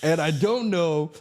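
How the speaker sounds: real laughter breaking through high, slow words; giggling helplessly while trying to explain; laughing. Real, not acted. Talking to the host while laughing helplessly, high and slow. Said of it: "I bust out laughing".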